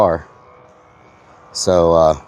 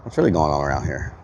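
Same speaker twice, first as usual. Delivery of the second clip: much lower, softer gruff mock voice; deep mock voice; 8 semitones lower, 5 dB quieter than usual. Streamer repeating a viewer's question, deep gruff voice. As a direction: growl it like a suspicious cop.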